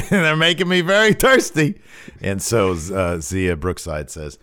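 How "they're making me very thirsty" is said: high, laughing mock-character voice; hammed-up high-pitched comic delivery; hammed-up quote. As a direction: play the joke line up high, with a laugh. Playing along with co-host's thirsty joke.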